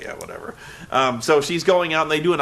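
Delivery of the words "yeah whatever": low, much softer brush-off; dismissive. Retold or imitated. Dolph brushing off his jealous manager, low and dismissive. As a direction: shrug it off like you don't care. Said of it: "Dolph Ziggler's like".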